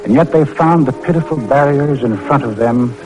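ordinary talk

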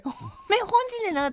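high-pitched Akita dialect demo. Shown as a demonstration. Repeating the local phrase for co-host, Akita dialect. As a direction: give an example in Akita dialect. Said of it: "秋田弁でもね".

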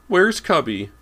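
put-on very high, loud child's demand; shrill demand. A narrator's reading. Boy searching for his dog, demanding shrilly. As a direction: demand it shrilly at the top of your voice. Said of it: "James was demanding shrilly".

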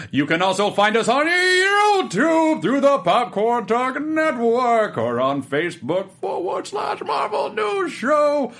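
high, slow, loud cosmic oracle; booming mystic voice. Pretend. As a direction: announce like an all-seeing magic eye. Closes the show as the Eye.

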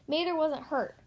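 plain reading voice

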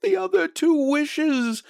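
very high, excited reply; urgent. A narrator's reading. Wife explains the remaining wishes to husband, urgent. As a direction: press the idea on him eagerly. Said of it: "she replied rapidly".

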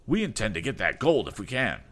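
high defiant voice; defiant rival. Acted. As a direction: shout it back defiantly.

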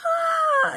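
very high acted cry of pain; mock scream. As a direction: breathe in, then a high, loud "ah". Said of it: "I didn't scream loud".

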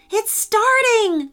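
high, drawn-out excited exclamation. A narrator's reading. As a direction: announce it with thrilled anticipation. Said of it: "said Jane excitedly".

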